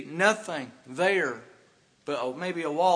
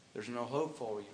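much softer, lower, grave; quiet somber warning to the lost; hushed warning; 6 semitones lower, 13 dB quieter than usual. Real, not acted. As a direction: drop the voice to a solemn hush. Preacher drops to quiet warning.

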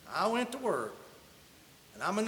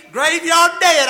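much louder, higher vow; fierce vow; 7 semitones higher, 15 dB louder, slower than usual. Retold or imitated. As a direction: swear it loudly, dead serious. Vowing on the stove, worked up.

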